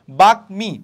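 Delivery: বাকমি loud, as bak-mi; m fully sounded. Shown as a demonstration. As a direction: keep the m in বাকমি. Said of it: "ম হারায় যাচ্ছে না".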